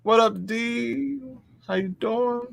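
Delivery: high goofy cartoon voice; lobster voice. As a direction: greet them in a squeaky silly voice. Greeting chat in put-on voice.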